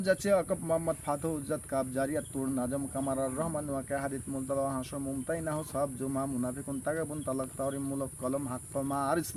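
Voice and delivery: reciting surah names. Acted. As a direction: rattle off the surah names like a memorised list. (নিচু সুরে একটানা সূরার নাম আবৃত্তি)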